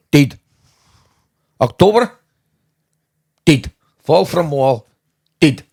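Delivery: put-on blunt Bulgarian accent, October pushed loud and high; flat, blunt Bulgarian news. Host breaking the death news to the group, blunt Bulgarian accent. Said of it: "like in a Bulgarian".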